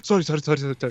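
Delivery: rapid-fire imitated apology; apologetic. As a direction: rattle off apologies as the startled stranger. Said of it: "he just gets so apologetic".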